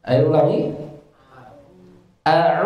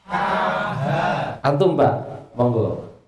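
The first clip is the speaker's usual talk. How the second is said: ordinary talk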